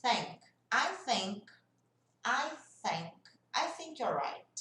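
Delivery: "think" with tongue between the teeth, blowing out; slow careful "I think", tongue out and blown; TH demo. Shown as a demonstration. ("think" com a língua entre os dentes, soprando)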